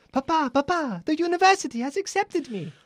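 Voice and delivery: high-pitched Italian son with news; bad Italian accent. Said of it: "I'll do bad Italian".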